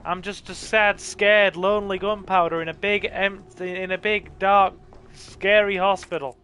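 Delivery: put-on pleading gunpowder child. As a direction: beg like a scared little kid, hammed-up. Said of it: "It'll be like".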